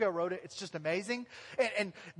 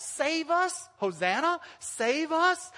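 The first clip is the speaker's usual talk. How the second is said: raised mock crowd cry, higher; crowd shout; 6 semitones higher, 4 dB louder, slower than usual. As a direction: call out Save us like the crowd. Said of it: "they shout".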